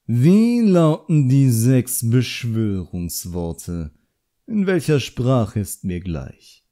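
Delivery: slow, relishing every word; a smug examiner stretching each word out, slowly; slow, savouring. A narrator's reading. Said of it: "sprach plötzlich viel langsamer, wobei er jedes Wort genüsslich auskostete".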